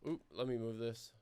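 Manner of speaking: normal talking voice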